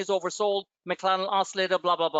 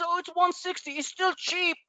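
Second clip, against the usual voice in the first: higher, softer mock groomer voice; 10 semitones higher, 3 dB quieter than usual. Mimicking Luis defending his rate, in a higher, softer mock voice. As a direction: parody his smug excuse lightly.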